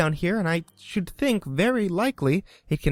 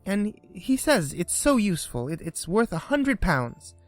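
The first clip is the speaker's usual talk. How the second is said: put-on higher, eager rush of girlish praise; eager chatter; 6 semitones higher than usual. A narrator's reading. Alice eagerly describes a terrier to the mouse. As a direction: gush about the neighbor's dog. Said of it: "so Alice went on eagerly".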